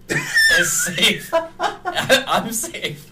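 very high, laughing squeak; squeaky laughing voice. Pretend. Joking about hiding in closet.

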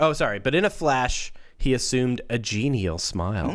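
ordinary talk, nothing marked

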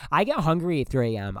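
ordinary talk, nothing marked

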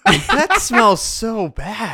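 high, slow, laughing disgust; disgusted by smell. Real, not acted. Smelling the hot chip.

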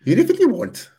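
reluctant high put-on Welsh accent; a quick, grudging stab at a Welsh accent, pitched high. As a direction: Welsh accent, done on a dare. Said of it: "do a Welsh accent".

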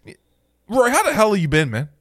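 normal talking voice

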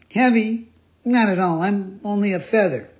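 high, loud protest; eager puppet boy. Acted. Pinocchio assuring the pigeon he is light, high and eager. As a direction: brush off the question in a high child's voice.

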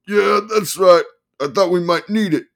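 high, loud manic lawyer; excited manic attorney. Acted.

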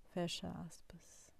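whispered closing cue; dictating. Acted. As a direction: breathe it out softly. Dictating quote marks.

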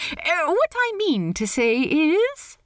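high, lilting, polite voice; awkward host in a high register; Steed, politely awkward. Acted. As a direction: pitch it high, hesitate politely. Steed fumbles on.

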